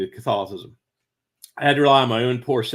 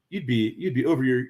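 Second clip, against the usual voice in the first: ordinary talk, nothing marked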